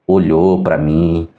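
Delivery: usual speaking voice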